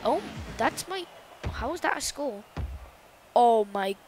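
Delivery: usual speaking voice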